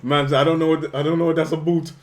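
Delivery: loud mock UK accent. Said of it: "the U K accent".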